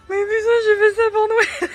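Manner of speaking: high put-on voice of an annoyed shopper; mock outraged voice. Voicing a made-up shopper, mock outraged and high. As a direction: squeal it out like a caricature of someone fed up.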